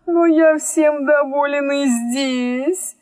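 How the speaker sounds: high, slow, drawn-out protest; objecting storyteller character. A narrator's reading. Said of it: "возразил Абдул-Надул".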